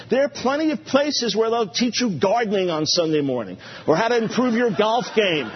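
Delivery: usual speaking voice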